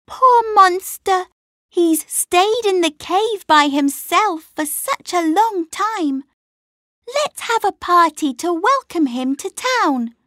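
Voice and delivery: put-on high, sympathetic girl voice; little girl voice. A narrator's reading. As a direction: play an eager little girl.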